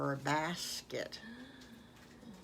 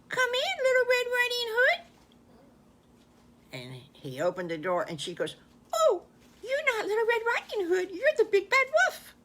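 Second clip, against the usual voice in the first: much higher, louder granny voice; a squeaky grandma welcoming, then shrieking in alarm; high, loud storybook granny taken by surprise; startled grandma; 6 dB louder than usual. Acted. Grandma answers, then recognises the wolf.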